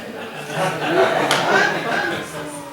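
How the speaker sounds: long high airy laugh; a drawn-out breathy laugh. Real, not acted. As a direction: laugh at the gossip joke.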